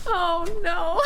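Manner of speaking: high laughing fit. Real, not acted. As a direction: squeal with laughter.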